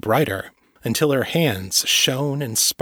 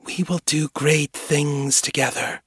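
voice behind door; 4 semitones higher than usual. A narrator's reading. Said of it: "said the voice behind the door".

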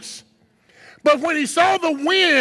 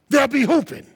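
ordinary talk, nothing marked

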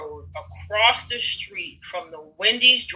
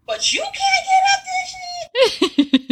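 high, slow laughing cry; half-laughing, half-wailing about the hill; laughing shout; 3 dB louder, slower than usual. Real, not acted. Telling the host about the steep hill, laughing and wailing.

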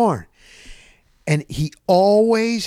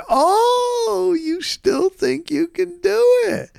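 much higher, slow mock voice; teasing disbelief; much slower than usual. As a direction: tease him in a high squeaky voice. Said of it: "I said".

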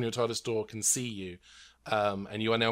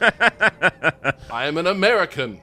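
slow, loud mock American; hammed-up American, stretched out; attempted American accent; 5 semitones higher, 7 dB louder, slower than usual. Shown as a demonstration. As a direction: declare it in an exaggerated American accent. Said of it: "your attempt to sound American".

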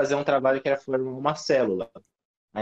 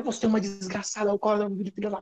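higher, a rattling string of mock-furious insults; machine-gun insult rant; 8 semitones higher than usual. Retelling how he unloaded on the classmate who ate the project, rapid-fire rant. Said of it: "Aí eu virei uma metralhadora".

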